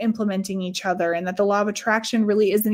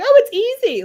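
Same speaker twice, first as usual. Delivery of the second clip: much higher, louder, airy and carefree; breezy friend imitation; 7 dB louder than usual. Mimicking her event-planner friend, breezy and high. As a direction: toss it off like it's nothing at all.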